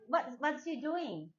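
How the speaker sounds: normal talking voice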